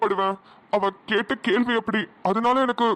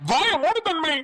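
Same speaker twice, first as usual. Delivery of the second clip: higher-pitched, sharp outburst; father's raised-voice rebuke; angry shout; 7 semitones higher, slower than usual. Acted. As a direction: silence them with an angry yell. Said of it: "நான் கத்தி பேசினதுனால".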